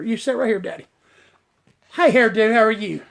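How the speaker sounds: ordinary delivery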